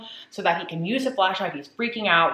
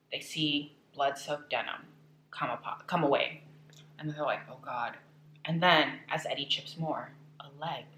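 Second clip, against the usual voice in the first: much softer, dropping toward a whisper; hushed spooky storytelling; 3 semitones lower, 11 dB quieter than usual. Acted. Telling viewers the gruesome discovery, hushed and spooky. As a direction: draw listeners in with a low, eerie hush.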